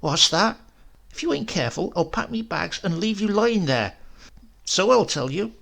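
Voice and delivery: high, indignant retort; a high, huffy old housekeeper. A narrator's reading. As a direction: snap back like an offended housekeeper. Housekeeper snapping back at him.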